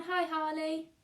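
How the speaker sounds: ordinary delivery, nothing marked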